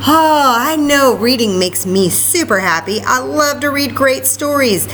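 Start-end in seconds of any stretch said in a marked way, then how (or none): none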